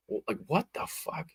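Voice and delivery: normal talking voice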